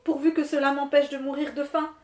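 the starving miller begging the wind lord; pleading miller. Acted. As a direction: ask meekly for anything.

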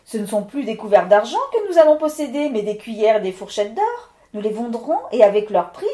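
excited wife. Acted. Claudine urging her husband to strike the tonneau, eager and hurried. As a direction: babble eagerly, urging him on.

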